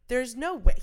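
loud, high there's no way; disbelieving exclaim. Retold or imitated. Passing Kiefer on the street, loud disbelieving outburst. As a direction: blurt it out in disbelief. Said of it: "and I'm like".

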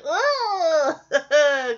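loud grossed-out groan with a laugh; disgusted ugh. Retold or imitated. Host reacting to the gross snack, laughing disgusted groan. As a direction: recoil from something gross. She recoils in disgust too.

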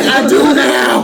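mock shout. Imagined comeback to ranting woman.

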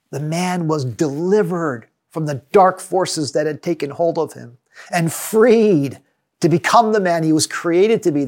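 ordinary delivery

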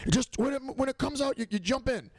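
high-pitched imitated yell; yelling from the cave. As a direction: call out from inside a cave, high. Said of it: "I can hear him yelling, like, echoing in the cave".

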